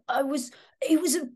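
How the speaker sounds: high, loud Cockney character; Cockney accent. Acted. Actor showing how Dennis first sounded, Cockney accent. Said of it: "with a bit of a Cockney accent".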